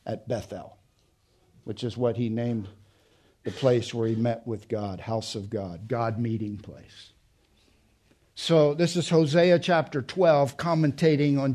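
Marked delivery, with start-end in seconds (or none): 0.1-7.1 much softer, drawn inward; hushed. Real, not acted. Preacher turning reverent at Bethel, hushed. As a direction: let it fall to a hush. Voice drops to near hush.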